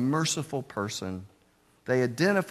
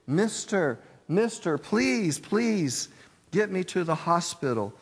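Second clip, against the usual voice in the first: a higher, urgent plea, lightly voiced; pleading father; 7 semitones higher than usual. Desperate man begs reporter for help, mildly acted plea. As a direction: beg the reporter to save the girl. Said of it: "And he pleaded".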